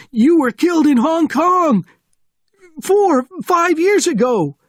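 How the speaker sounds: very high, loud screamed protest; panicked scream. A narrator's reading. Sergeant telling Bourne he was killed, panicked screaming. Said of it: "screaming, his eyes wide in panic".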